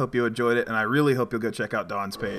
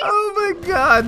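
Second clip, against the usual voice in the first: high, stretched out oh my God; excited shriek; 9 dB louder, slower than usual. Real, not acted. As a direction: squeal it in delight. Reacting to chaotic game moment.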